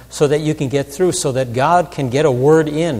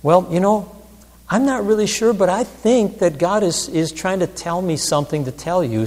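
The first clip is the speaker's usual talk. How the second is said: higher, hedging lead-in; tentative preface; 6 semitones higher than usual. Retold or imitated. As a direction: ease gently into an uncertain message, pitched up. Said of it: "sometimes I preface those, those things with".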